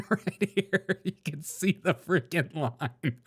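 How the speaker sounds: cracking up mid-sentence; laughing. Real, not acted. Host admiring a man's comb over, really laughing while talking.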